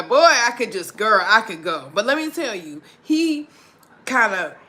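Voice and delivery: normal talking voice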